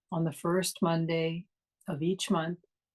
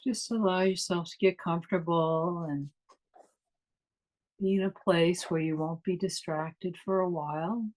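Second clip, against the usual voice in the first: guided meditation. Acted. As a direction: ease them inward, unhurried and calm.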